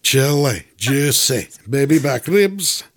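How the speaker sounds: hammed-up Scottish accent, stretching the last words; Fat Bastard. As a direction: savour it in a cartoonish Scottish brogue. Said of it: "do a couple lines of fat bastard".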